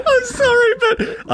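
high squeal of laughter; laughing. Real, not acted. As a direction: apologise through a burst of laughter. Cracking up at friend.

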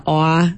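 "aw" as a drawn New Yorkish vowel; Yat aw vowel. Shown as a demonstration. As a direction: sound out "aw" as the Yat accent's vowel. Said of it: "it's this".

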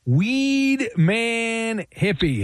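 long stretched-out introduction; slow hyped announcer call; announcer intro. Acted. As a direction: draw out every word like a ring announcer. Host introduces caller, drawn out.

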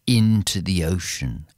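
ordinary delivery

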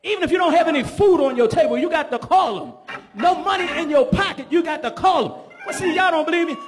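preacher rouses the congregation; preaching at full force. Acted. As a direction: build it up and ring it out.

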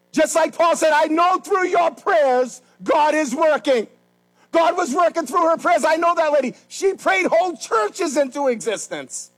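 fiery pulpit climax; preaching. Acted. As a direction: preach it out hard and slow.

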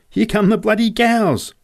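alarmed shout. A narrator's reading. Boy warning Joe of the stampeding cows, alarmed shout. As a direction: yell the warning at the top of your voice. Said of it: "I shouted".

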